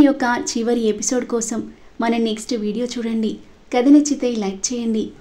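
soft, quiet sign-off read; channel outro. Acted. Storyteller closes the episode, asking for likes, softly. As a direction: gently wrap up and ask listeners to subscribe.

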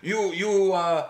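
a hint of a cross old French guy; imitating French guy. Retelling the angry Montreal man to co-host, mock accent. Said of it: "an old French guy in Montreal".

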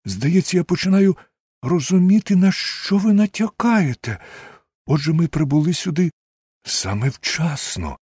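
high-pitched, alarmed realization; worked up, pitch held well above normal; agitated exclamation. A narrator's reading. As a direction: exclaim it high and urgent, the truth dawning. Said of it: "вигукнув я".

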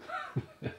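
high burst of giggling; giggle. Real, not acted. Laughing at her partner's new phrase.

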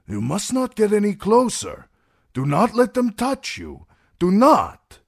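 high, loud warning shouted after the queen; urgent shout. Acted. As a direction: shout to stop her from going closer. Jogo urgently stops the queen.

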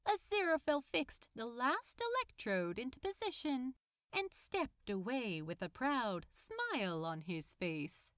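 ordinary delivery

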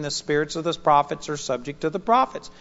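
ordinary talk

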